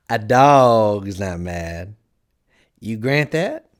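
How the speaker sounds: loud, drawn-out cat voice; slow pronouncement, then a rising check; explaining Cheshire Cat. A narrator's reading. Cat starting its proof, loud and drawn out.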